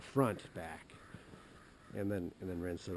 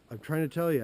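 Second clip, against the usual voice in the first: higher, louder frustrated cry; exasperated; 9 semitones higher, 11 dB louder than usual. Retold or imitated. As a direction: snap back in exasperation. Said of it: "I'm like".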